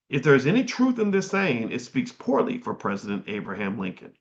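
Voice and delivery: ordinary talk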